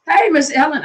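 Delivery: high bright greeting. Real, not acted. Greeting a viewer by name.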